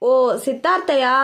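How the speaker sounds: a pointed, overheard-on-purpose call; deliberately loud. A narrator's reading. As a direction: say it loud enough for her to hear. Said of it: "என வேண்டுமென்றே சத்தமாக கேட்டான்". (வேண்டுமென்றே சத்தமாக)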